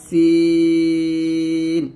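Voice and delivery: সিন with thin s and a very long held 'ee'; thin hissed s. Shown as a demonstration. Teacher modelling the letter for learners, সিন thin and long. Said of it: "নিচে দাঁতের সাথে লাগিয়ে পাতলা করে".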